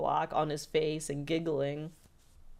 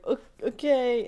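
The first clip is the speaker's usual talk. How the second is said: higher, louder awkward stammer; Dipper; 7 semitones higher, 5 dB louder than usual. Retold or imitated. Dipper caught in a tight hug, awkward. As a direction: stumble into a hesitant okay. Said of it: "and Dipper's like".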